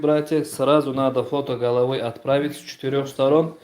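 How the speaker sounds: usual speaking voice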